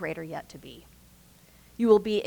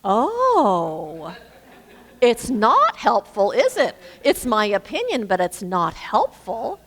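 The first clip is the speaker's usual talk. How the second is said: mock indignant retort; 2 semitones higher, 6 dB louder, slower than usual. Speaker retells answering the spouse's criticism, mock indignation. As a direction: throw the words back, acting affronted. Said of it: "That was, I was like".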